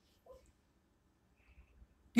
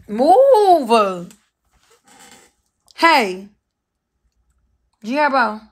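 sharp loud commands; loud calls; calling out; 8 dB louder, much slower than usual. Real, not acted. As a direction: shout at the dog to move. Calling out to dog.

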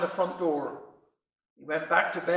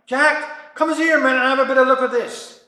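calling out; 4 semitones higher, 6 dB louder than usual. Retold or imitated. Wife calling husband to kitchen.

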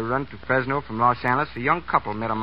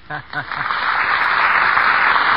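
loud laughter; 2 semitones higher, 7 dB louder than usual. Real, not acted. Laughter at sign-off.

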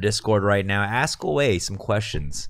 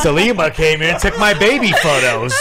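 higher, louder, laughing accusation; 7 semitones higher, 7 dB louder than usual. Real, not acted. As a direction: tease through laughter.

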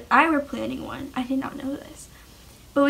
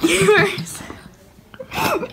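breathy laugh; 6 semitones higher, 5 dB louder, much slower than usual. Real, not acted. Laughing while overwhelmed.

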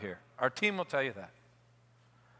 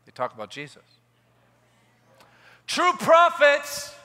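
raised, ringing pulpit voice; fervent preaching; 8 semitones higher, 5 dB louder, slower than usual. Acted. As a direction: preach it out to the crowd. Preaching at full force.

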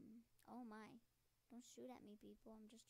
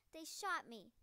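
loud, high cry of protest; real outraged yell; 5 semitones higher, 15 dB louder, slower than usual. Real, not acted. Player just shot in game, real outraged yell.

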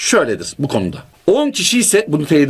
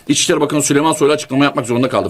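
ordinary delivery

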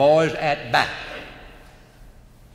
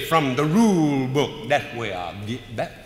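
smug reply. Retold or imitated.

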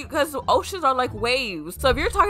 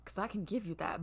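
very soft, suggestive aside; quiet, teasing come-on; hushed insinuation; 4 semitones lower, 18 dB quieter than usual. Real, not acted. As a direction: drop right down to a near-murmur. Flirty aside to the singer.

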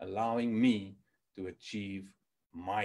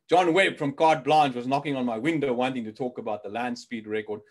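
usual speaking voice

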